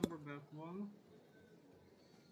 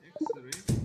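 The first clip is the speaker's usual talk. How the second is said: loud; 19 dB louder than usual. Real, not acted. Sudden loud query to game.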